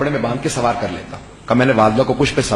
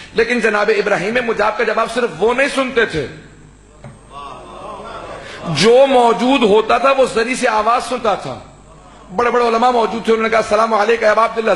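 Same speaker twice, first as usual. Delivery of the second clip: high, ringing majlis preaching; impassioned Urdu sermon delivery; full-force preaching; 7 semitones higher than usual. Acted. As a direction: preach it out to the whole hall.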